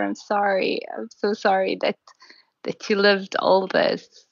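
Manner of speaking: ordinary talk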